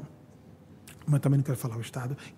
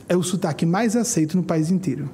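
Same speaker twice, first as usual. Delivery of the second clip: ordinary delivery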